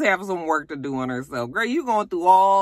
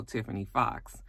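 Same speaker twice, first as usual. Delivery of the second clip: ordinary talk, nothing marked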